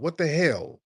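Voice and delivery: usual speaking voice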